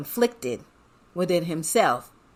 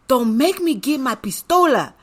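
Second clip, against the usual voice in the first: loud, higher mock threat; imitated angry man; 6 semitones higher, 8 dB louder than usual. Angry man joking to the receptionist, loud mock threat. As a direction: bellow a playful threat in his voice. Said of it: "and he goes".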